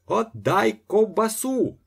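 high, slow, stretched cry; wailing shout. A narrator's reading. Ostap demanding his sausage back, high wail. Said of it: "заголосив Остап".

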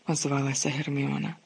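usual speaking voice

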